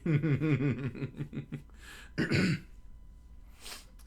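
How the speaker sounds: long high giggling fit; helpless real laugh; real laughter. Real, not acted. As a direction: lose it laughing at the text. Reader cracks up at the story.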